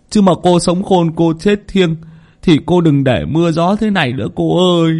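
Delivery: lightly voiced mournful servant; servant lamenting. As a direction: grieve aloud to the dead girl, pleading. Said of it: "vừa dọn vừa lẩm bẩm".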